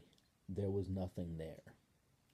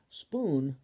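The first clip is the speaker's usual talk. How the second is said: ordinary delivery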